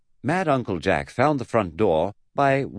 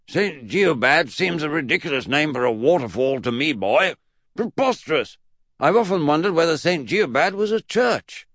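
high, emphatic eccentric pondering; loud pompous dismissal, pitched up; Mad Uncle Jack; 9 semitones higher, 4 dB louder than usual. Acted. Great-uncle rejects Eddie's waterfall idea, high pompous voice.

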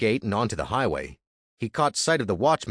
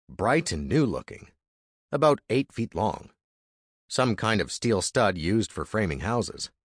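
usual speaking voice

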